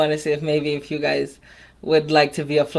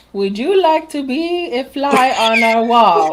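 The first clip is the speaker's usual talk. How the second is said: odd reading voice; 8 semitones higher, slower than usual. Pretend. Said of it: "Why did you read it like that?".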